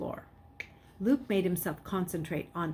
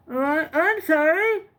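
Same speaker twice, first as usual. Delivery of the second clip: much higher, louder nervous stammer; stammering apology; 9 semitones higher, 7 dB louder, slower than usual. A narrator's reading. Boy pretending to be Jason, stammering sorry. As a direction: stammer it out, scared. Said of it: "he muttered".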